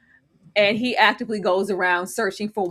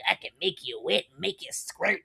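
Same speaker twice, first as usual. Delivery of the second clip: lower, much softer sleazy come-on; mock seductive murmur; 4 semitones lower, 8 dB quieter than usual. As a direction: purr it sleazily. Mocking Rick's seduction.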